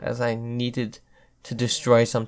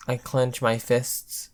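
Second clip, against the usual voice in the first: ordinary delivery, nothing marked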